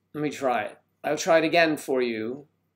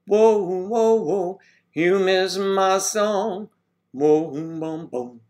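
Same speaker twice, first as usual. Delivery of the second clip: higher, louder excited whoas; excited, higher sing-song whoas; 5 semitones higher, 5 dB louder, much slower than usual. Real, not acted. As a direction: greet her with a burst of excitement. Excited greeting with sung bits.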